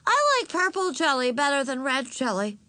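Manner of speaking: put-on high child voice, butting in; interrupting little girl. A narrator's reading. Little sister interrupting her sister's story, childishly opinionated. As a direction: announce it high like a small child. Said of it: "insisted Ramona".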